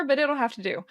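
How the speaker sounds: usual speaking voice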